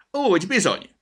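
high, loud market-woman call; booming approval from a stallholder; loud agreement. A narrator's reading. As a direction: agree heartily at full market volume. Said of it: "helyeselt jó hangosan a kofa".

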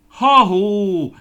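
high, loud cheery hail; calling out. Acted. Guszti hails the plums from under the tree, loud call. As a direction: shout a bright hello across the orchard. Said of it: "kiáltotta".

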